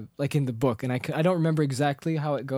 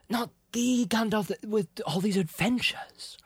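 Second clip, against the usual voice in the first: wistful Bilbo; 6 semitones higher, slower than usual. Retold or imitated. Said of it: "Bilbo's like".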